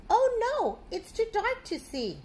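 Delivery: high, loud mock alarm; hammed-up storybook fright. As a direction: sound the alarm for the children. Alarm in dark cave.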